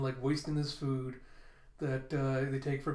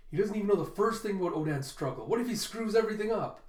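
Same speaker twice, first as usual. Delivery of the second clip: worked-up rant; 6 semitones higher, 4 dB louder, faster than usual. Acted. Luffy fretting about Jaggy.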